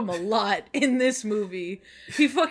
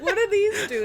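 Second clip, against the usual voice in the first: high Pepe voice; 12 semitones higher, 6 dB louder than usual. Retold or imitated. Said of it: "she's like".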